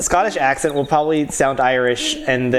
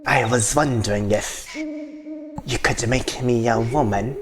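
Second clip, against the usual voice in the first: lower, hushed creepy knight; 5 semitones lower, 4 dB quieter than usual. Acted. Knight asks the mage a favour, low creepy voice. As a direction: ask it low and furtively. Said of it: "totally pedo".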